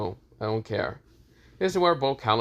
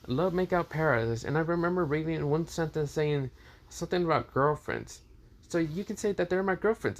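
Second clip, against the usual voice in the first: a lightly voiced excited little kid, pitched higher; innocent child; 6 semitones higher than usual. Issei, proudly showing his new friends to Kakashi, as a lightly voiced innocent child. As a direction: chirp it out like an eager young boy showing his dad. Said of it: "acting like an innocent child".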